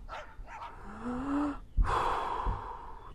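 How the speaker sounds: high breathy intake; deep gasping breath. A narrator's reading. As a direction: gasp in a long deep breath. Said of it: "took a deep, deep breath".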